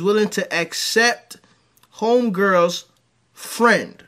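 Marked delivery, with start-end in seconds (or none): none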